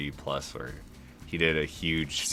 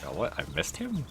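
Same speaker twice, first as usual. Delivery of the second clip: surprised; 9 semitones higher than usual. Real, not acted. Missed an enemy in game.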